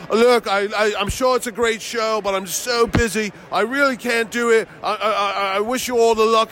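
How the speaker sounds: mock a self-important guest declining; pompous brush-off. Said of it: "the guy turns around and goes".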